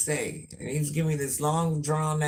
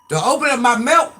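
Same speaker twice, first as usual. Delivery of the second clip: high, loud threatening call; loud warning; 8 semitones higher, 11 dB louder than usual. Real, not acted. Parent warning the family off the milk, loud shout. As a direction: shout the warning so the whole house hears.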